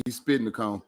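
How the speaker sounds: usual speaking voice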